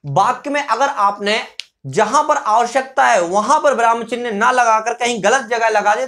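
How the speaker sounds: normal talking voice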